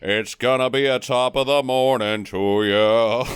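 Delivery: slow, hammed-up British Isles accent; mock British accent. Co-host teasing about picking up the accent, mock British accent. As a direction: drag out a cartoon British accent. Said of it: "you start picking up the accent".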